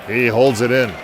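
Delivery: brisk hockey announcer calling the action; live play-by-play. Acted. Announcer calling the hockey game for listeners, in brisk play-by-play. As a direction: call the game as it happens, rising on big plays.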